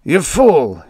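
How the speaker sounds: high, loud outburst of rage; screamed. A narrator's reading. Tusman in mad rage at his servant, screaming. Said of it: "he screamed".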